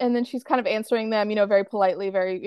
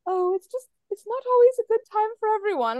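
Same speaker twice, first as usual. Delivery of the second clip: mild Iris; 11 semitones higher than usual. Retold or imitated. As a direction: imitate a mild-mannered aunt.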